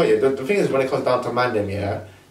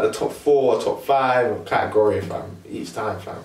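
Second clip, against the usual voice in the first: normal talking voice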